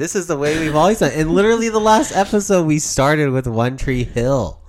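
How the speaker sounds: exasperated. Real, not acted. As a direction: argue it out, exasperated. Exasperated argument.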